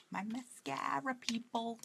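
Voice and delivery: New York accent. Real, not acted. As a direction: stretch "mascara" in a Brooklyn accent. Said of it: "my New York accent comes out quite a lot".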